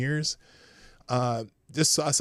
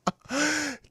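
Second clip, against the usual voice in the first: high laugh breaking out again. Real, not acted. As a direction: still cracking up. Reacts to a wild clip.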